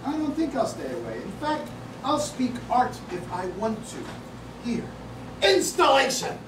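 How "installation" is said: high, loud exclamation; loud shout. Acted. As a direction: blurt it out. Mock art attack, calling out.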